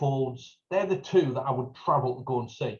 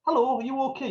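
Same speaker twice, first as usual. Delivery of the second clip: much higher, sing-song hello; cheery street greeting; 11 semitones higher, faster than usual. Retold or imitated. Greeting a stranger in the street, sing-song and high.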